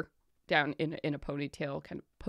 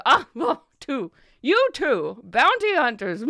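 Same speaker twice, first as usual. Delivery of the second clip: merchant voice; 7 semitones higher, 7 dB louder than usual. Acted. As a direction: relish the words.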